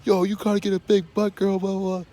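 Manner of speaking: loud, high mocking street-guy impression; mock hype guy. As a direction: ham up a guy calling out to a girl.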